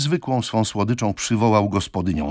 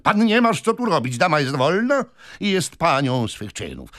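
high, raised quarrelling voice; heated defiance; 9 semitones higher, 4 dB louder than usual. A narrator's reading. Men quarrel over who must leave, heated defiance. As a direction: argue back hotly, pitch up.

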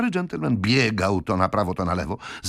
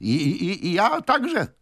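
high, halting agreement; stutter, then squeeze out the agreement high; stammering Pickwick; 12 semitones higher, slower than usual. A narrator's reading.

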